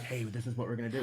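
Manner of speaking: low, very soft imitation of secret talk; mock discreet mid-match instruction; hushed aside. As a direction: drop low and soft, as if secretly passing on a plan. Imitating wrestlers planning moves mid-match.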